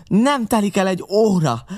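first, wildest cry of the threat; furious threat. A narrator's reading. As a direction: scream the warning. Pirate's furious parting threat.

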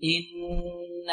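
slow إن with its nun hummed at length; full ghunnah. Shown as a demonstration. Teacher models ghunnah for students, full nasal hold on إن. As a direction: hold the doubled n of إن in a long, soft nasal hum. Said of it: "هنا غنة أكمل".

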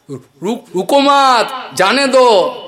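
the rich master stammering a shout from the roof. Retold or imitated. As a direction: stutter on রুক-, then yell রুকো মাত, জানে দো high, loud and slow. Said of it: "বাবু তোতলা স্বরে বলছে".

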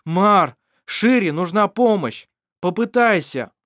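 loud, high urging call. Acted. Vassar urging Mar loudly to help Shira. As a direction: push him to act.

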